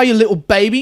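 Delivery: ordinary talk, nothing marked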